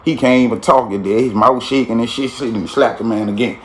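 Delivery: usual speaking voice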